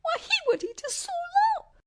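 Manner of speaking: very high, squeaky alarm; alarmed mouse cry. A narrator's reading. Mouse queen fears a lion will eat them, alarmed squeak. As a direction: squeak it as if about to be eaten. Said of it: "cried the little queen".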